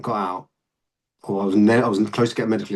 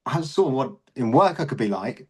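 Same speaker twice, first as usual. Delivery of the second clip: ordinary delivery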